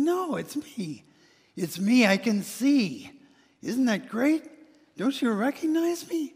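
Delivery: high and loud, slowed, begging to be seen; eager pleading. Acted. Healed man begging neighbors to know him, eager and high.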